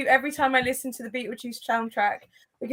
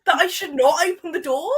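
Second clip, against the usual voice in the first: laughing; 6 semitones higher, 7 dB louder, slower than usual. Real, not acted. Laughing half-shout.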